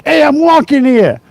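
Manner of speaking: high, punchy New York-Boston street voice; mock Boston accent. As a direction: belt it out like a Boston wiseguy. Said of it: "I've heard it, but I'm just not very good at it".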